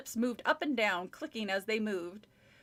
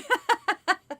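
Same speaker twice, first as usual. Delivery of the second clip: loud laugh; 10 semitones higher, 8 dB louder than usual. Real, not acted. Laughs at book ending.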